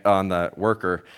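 normal talking voice

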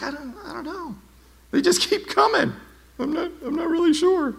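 very high, soft clueless voice; mock clueless. Burned person answering friends about scars, high mock-clueless voice. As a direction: squeak out a baffled, feigned-innocent reply.

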